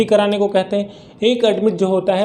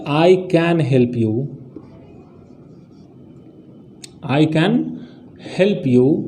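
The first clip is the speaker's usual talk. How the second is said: "I can help you" drawn out word by word; dictating the example sentence slowly; slow dictation; 3 semitones lower, much slower than usual. Shown as a demonstration. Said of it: "example से आपको समझाने".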